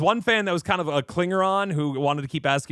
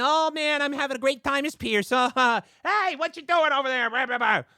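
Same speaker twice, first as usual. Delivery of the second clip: pitched way up, light and airy; high voice; 14 semitones higher than usual. Shown as a demonstration. Showing fans his VidCon voice, pitched way up. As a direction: talk up high like a cartoon. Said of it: "talking up like this".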